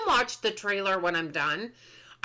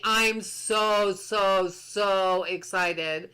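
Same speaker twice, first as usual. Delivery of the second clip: drawn-out excitement; 5 semitones lower, much slower than usual. Real, not acted.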